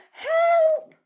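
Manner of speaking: very high, loud squeal; drawn-out storybook cry for rescue. A narrator's reading. Narrator voices the other frightened sister, crying out. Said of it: "And the other cried out".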